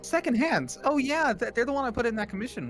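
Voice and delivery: high, surprised recognition; light up in recall. Real, not acted.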